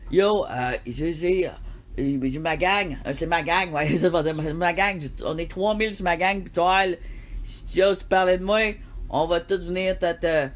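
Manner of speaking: loud Montreal gang talk; Montreal street accent. Shown as a demonstration. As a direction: play a Montreal street tough. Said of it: "C'est pas ça l'accent de Montréal".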